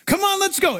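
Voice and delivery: high, eager call; high-pitched mock enthusiasm; eager urging. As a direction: urge them along in a high voice. Voices eager follower against doubters.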